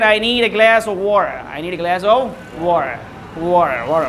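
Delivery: slow American "water", t softened away, r sounded; drawn-out American "wah-rer" for "water"; American accent. Shown as a demonstration. Teacher shows class water "in American", American accent. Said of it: "All right, in American".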